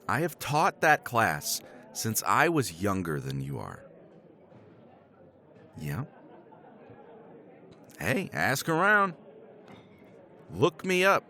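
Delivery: ordinary delivery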